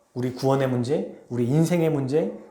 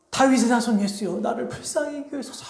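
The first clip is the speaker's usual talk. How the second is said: high, strained plea, volume held back; acted crying out; 7 semitones higher than usual. Blind beggar pleads with Jesus for mercy, high acted cry. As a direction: mimic a far-off cry for mercy, pitched high. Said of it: "이렇게 외칩니다".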